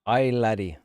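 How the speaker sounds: loud Scottish accent; Scotty. Shown as a demonstration. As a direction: call it out with a Scottish burr. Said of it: "sounds like Scotty from Star Trek".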